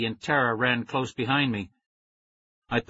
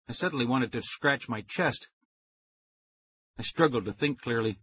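plain reading voice

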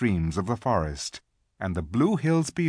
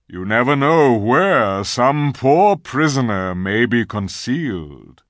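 loud, drawn-out rallying order; commanding lion; 2 semitones higher, 8 dB louder, slower than usual. A narrator's reading. Aslan orders every corner searched for prisoners, commanding. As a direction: marshal the searchers slowly and loudly. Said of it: "said Aslan".

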